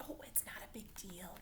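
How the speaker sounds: unvoiced bashful whisper; teacher whispering to show modesty; modest whisper. Shown as a demonstration. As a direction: whisper it like a shy modest kid. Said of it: "teacher demonstrates modest tone".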